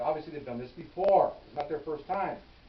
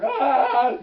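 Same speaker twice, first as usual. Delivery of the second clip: high, loud mock scream; kids' scream; 11 semitones higher, 14 dB louder than usual. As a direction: shriek in fright. Kids screaming again.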